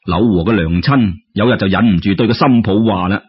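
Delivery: ordinary delivery, nothing marked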